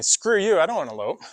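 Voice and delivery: sassy, defiant horse talking back; bratty attitude. Retold or imitated. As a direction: voice a stubborn horse saying no. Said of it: "that little attitude saying".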